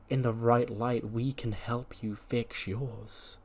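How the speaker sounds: very slow, softened offer of help; soothing reassurance. Acted. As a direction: let it sink down softly and slowly.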